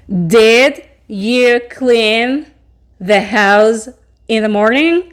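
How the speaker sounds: slow, careful English model sentence; slow teaching read. Shown as a demonstration. Teacher slowly models the question for the class. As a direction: spell it out slowly for students.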